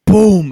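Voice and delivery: mock gunshot boom, shouted. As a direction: blast out a big boom. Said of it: "it sounded like a gunshot went off".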